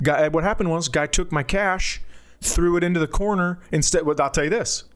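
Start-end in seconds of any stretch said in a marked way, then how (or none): none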